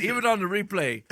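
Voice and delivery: ordinary talk, nothing marked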